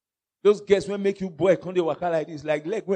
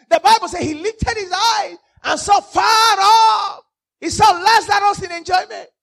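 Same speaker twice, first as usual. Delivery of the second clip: high, loud, drawn-out sermon shout; full-force preaching; 11 semitones higher, 10 dB louder than usual. Real, not acted. Preacher tells congregation what the rich man saw, full-force preaching. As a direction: build into a high, ringing preacher's cry.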